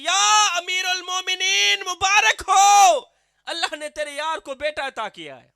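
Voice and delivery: loud, high, drawn-out call from inside the tent. Acted. Wife calling from inside the tent to announce the birth, loud and stretched. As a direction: call the news out joyfully. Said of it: "زوجہ محترمہ نے آواز دی".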